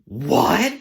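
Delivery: loud, high baffled "what"; hammed-up bewildered customer; confused customer. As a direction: burst out in total confusion. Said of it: "so confused".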